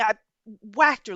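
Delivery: ordinary delivery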